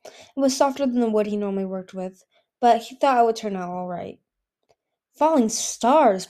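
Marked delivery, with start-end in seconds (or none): 5.1-6.3 slow, loud gasp of wonder; awestruck. A narrator's reading. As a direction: breathe it out in amazement, slowly. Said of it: "in an awestruck voice".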